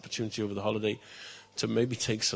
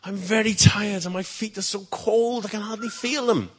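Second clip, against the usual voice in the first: high, louder pleading call; pleading shout; 9 semitones higher, 7 dB louder than usual. Acted. Tired page begging the king to stop, shouted high. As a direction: cry out to him, worn out and begging. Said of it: "he shouted through the wind".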